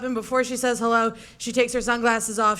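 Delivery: ordinary delivery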